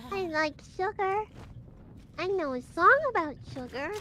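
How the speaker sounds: high, loud squeaky anime voice; squeaky anime girl. Acted. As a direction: chirp it like an excited anime girl. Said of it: "I can do the squeaky anime girl voice".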